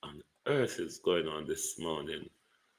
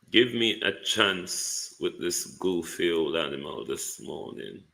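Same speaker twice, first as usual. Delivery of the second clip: ordinary delivery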